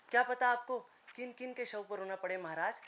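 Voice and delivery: normal talking voice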